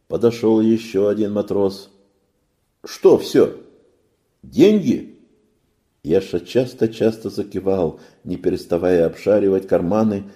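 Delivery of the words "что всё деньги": sailor questioning. A narrator's reading.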